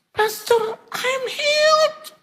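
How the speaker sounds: very high, loud, drawn-out squeal; ecstatic cry. Retold or imitated. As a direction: scream the good news, stretching the end. Acted phone call announcing healing. (jeritan bahagia melengking panjang)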